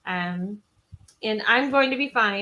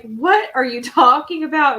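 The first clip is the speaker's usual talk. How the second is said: loud, raised incredulous question; baffled friend; 5 semitones higher, 8 dB louder than usual. Retold or imitated. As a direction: imitate a bewildered friend on the phone. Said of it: "And she's like".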